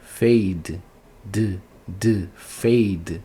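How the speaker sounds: fade, then its final D isolated; single out the D that ends fade; som final isolado. Shown as a demonstration. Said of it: "o que importa é o som". (fade destacando o som final de D)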